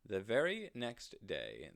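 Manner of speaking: ordinary delivery, nothing marked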